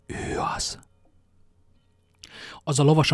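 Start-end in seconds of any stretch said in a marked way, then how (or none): 0.0-0.8 a hushed, breathy whisper; barely voiced, so no one hears. A narrator's reading. Little man whispering to his companions. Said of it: "halkan azt mondta".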